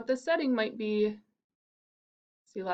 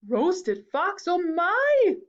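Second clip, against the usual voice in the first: higher, louder mock fright; alarmed fox; 8 semitones higher, 6 dB louder, much slower than usual. Fox hears he is food, mock panic. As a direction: squeal it in hammed-up panic.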